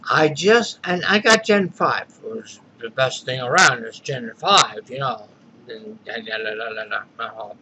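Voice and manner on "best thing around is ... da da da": low, mumbled mock babble; mock sales patter. Vlogger mocking the salesman who sold him Gen five, low mumbled babble. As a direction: brush off the salesman's pitch as empty noise. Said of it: "Yeah, great salesman".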